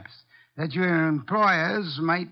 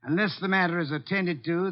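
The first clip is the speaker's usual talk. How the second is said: usual speaking voice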